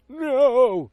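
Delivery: high, loud mock wail; mock anguish. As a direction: cry out in hammed-up grief. Mock reaction to friend's death.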